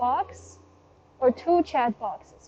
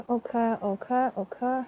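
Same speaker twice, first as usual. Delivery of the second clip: usual speaking voice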